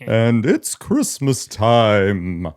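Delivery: very slow Santa voice. Pretend. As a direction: drawl it out as Santa. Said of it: "I can't do a friendly Santa voice".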